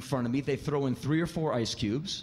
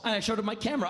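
usual speaking voice

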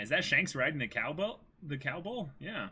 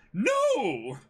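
much higher, loud stammering cry; distraught butler; 4 dB louder than usual. Acted. Merry to Kuro, crying out much higher and louder over the broken gift. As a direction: cry out in shock.

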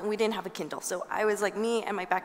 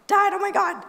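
much higher and louder, gushing with excitement; excited call; 11 semitones higher, 7 dB louder than usual. Retold or imitated. Phoning her dad after getting into Boston Ballet, bursting with excitement.

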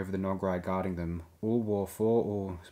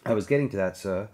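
louder, mildly put-out droid; a bit huffy; 7 dB louder than usual. A narrator's reading. Droid interrupted by Han mid-report, a bit huffy. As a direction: answer as if slightly affronted. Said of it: "sounding a bit huffy".

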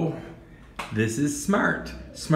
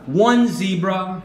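slow teaching voice. Shown as a demonstration. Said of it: "teacher models for child to repeat".

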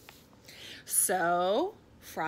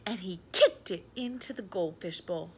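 ordinary delivery

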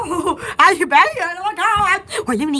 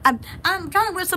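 usual speaking voice

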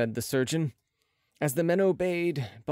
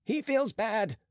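mildly alarmed, higher voice; Marat's alarm; 8 semitones higher, slower than usual. A narrator's reading. Marat watches the patient fade, mild higher-pitched alarm. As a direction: lift the pitch a little in concern.

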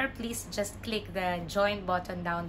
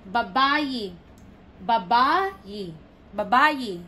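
"Babae" as ba-BA-e, louder middle; stress on second syllable; 5 dB louder, slower than usual. Shown as a demonstration. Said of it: "The stress is in the second syllable".

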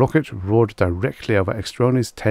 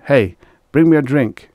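louder, higher command to a servant; barked order; 6 semitones higher, 8 dB louder than usual. A narrator's reading. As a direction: call out an imperious order. Commander orders a bearer around.

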